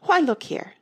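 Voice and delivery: high, loud exclamation; impatient queen crying out; cried out. A narrator's reading. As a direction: call her to attention. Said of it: "the Red Queen cried".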